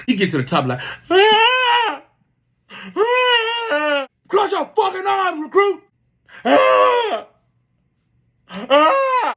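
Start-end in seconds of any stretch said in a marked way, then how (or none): 1.1-4.1 very high, loud sobbing howl; imitated crying wail. Recruit crying at the top. 4.3-5.8 very high, loud barked order; mock drill instructor shout. Instructor yelling at recruit. 6.4-9.3 very high, loud sobbing howl; imitated crying wail. Recruit crying.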